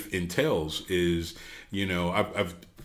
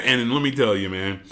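normal talking voice

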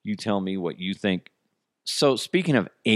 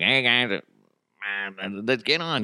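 badly faked comic character voice; half-hearted impression; Larry the Cable Guy; slower than usual. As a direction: attempt a caller's character voice, then give up. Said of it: "I can't do the voice".

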